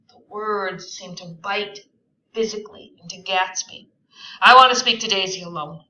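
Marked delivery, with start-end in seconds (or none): 4.4-5.8 fast, loud insisting; insistent. A narrator's reading. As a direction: press the demand quickly and loudly. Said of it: "he insisted".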